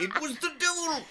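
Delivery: very high, cracking with laughter; laughing spooky witness. Acted. Cohost redoing his witness voice for the host, giggling and squeaky. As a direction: repeat the devil line while breaking up laughing.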